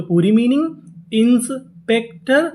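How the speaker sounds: slow, rising reveal of inspector; slow teacher reveal. Shown as a demonstration. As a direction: stretch the phrase toward the word.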